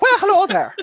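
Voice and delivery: high, loud put-on Irish-sounding lilt; botched Canadian that slides into Irish; Canadian gone Irish. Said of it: "then that just went Irish".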